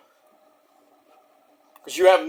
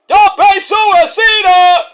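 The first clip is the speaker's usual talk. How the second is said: slow, stretched, very high, loud announcer shout; wrestling commentary call; 12 semitones higher, 9 dB louder, much slower than usual. Pretend. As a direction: belt it out like a ringside commentator calling the dive. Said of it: "I'll do some commentary".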